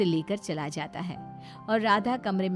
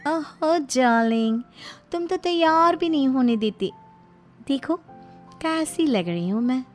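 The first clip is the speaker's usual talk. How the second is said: coquettish new wife; 5 semitones higher, 6 dB louder than usual. Acted. New wife showing off her outfit to husband, coquettish. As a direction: flirtatiously scold in a high, affected voice.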